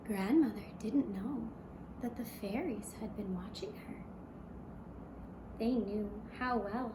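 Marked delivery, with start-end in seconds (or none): none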